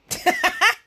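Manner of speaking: high, loud burst of laughter; real cackling laugh. Real, not acted. Vlogger laughing after naming her cousin on live, cackling.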